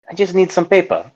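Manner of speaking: put-on French accent pushed harder on "I just need some paper"; retrying the line in a fuller French accent. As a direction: lean into the French accent, halting. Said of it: "this time I'll try harder".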